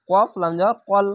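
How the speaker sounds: ordinary talk, nothing marked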